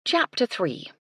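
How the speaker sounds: plain reading voice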